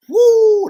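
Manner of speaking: high, loud excited whoop; excited woo. Real, not acted. Preacher fired up mid sermon, loud excited whoop. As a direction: let out a joyful shout.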